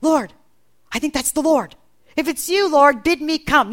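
Peter. Acted. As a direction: call up to someone at a distance. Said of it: "And Peter goes".

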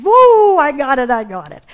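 loud, high whoop that falls away; celebrating, big high "Woo" then tumbling down; excited victory whoop. Acted. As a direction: whoop it out like you just won. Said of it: "you're excited and you".

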